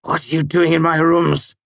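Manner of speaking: sharp, high angry demand. A narrator's reading. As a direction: demand it, outraged. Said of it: "he demanded".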